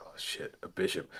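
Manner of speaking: hushed, half-whispered realisation; taken aback. Acted. Guild man learns he faces a bishop, hushed and taken aback. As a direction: shrink back under your breath. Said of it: "he, like, steps back a little bit".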